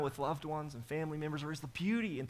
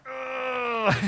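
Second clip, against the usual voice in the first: a mock angry groan breaking up; frustrated groan; 5 semitones higher than usual. Pastor acting out his anger at disruptions, frustrated groan. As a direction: groan out the frustration, half-laughing. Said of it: "I get angry".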